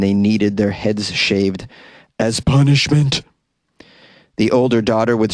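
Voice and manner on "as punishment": creepy, husky put-on voice; husky caller voice. Caller demanding her daughters' heads be shaved, husky voice. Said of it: "keep doing the one I'm doing".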